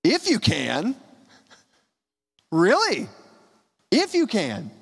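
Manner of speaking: Jesus echoing the father's words; gentle questioning. Shown as a demonstration. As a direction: answer with mild surprise, not scorn. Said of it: "this is not a scoff".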